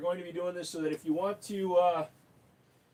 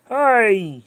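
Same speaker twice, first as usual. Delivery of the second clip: put-on thick Queens accent, higher and louder; New York accent; 4 semitones higher, 10 dB louder, much slower than usual. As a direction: ham up a Queens New Yorker announcing the schedule. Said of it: "talking like this".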